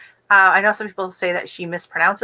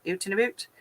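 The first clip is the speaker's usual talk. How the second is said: Canadian-accented 'Out and about', said softly; soft, self-conscious Canadian vowels on 'Out and about'; 8 dB quieter than usual. Shown as a demonstration. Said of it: "I think out is the word".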